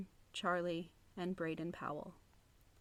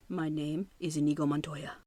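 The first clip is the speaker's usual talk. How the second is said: Inigo Montoya impression; 4 semitones lower, 4 dB louder than usual. Quoting the movie line.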